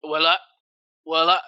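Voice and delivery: lightly imitated stuck recording; looping recording. Scam recording cut off by noise, mimicked stuttering loop. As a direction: stop dead and restart identically. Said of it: "it cuts".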